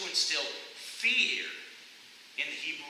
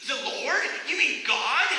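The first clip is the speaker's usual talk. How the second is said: astonished; 6 semitones higher, 3 dB louder than usual. Acted. Jack amazed it is God.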